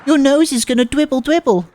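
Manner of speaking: put-on high, lisping childish warning; cute lisp, pointing out the nosebleed; baby-talk girl. Transfer student warning Nishizuo-kun, high lisping baby talk.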